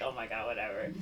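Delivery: low, soft, dismissive mutter. Retold or imitated. She brushes off the gossiping friends, dismissive. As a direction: brush them off under the breath.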